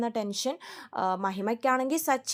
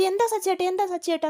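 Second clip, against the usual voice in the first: higher, louder alarmed asking; startled concern; 7 semitones higher, 4 dB louder than usual. Retold or imitated. Wife startled by her husband's outburst, alarmed question. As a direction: ask in a sudden fright.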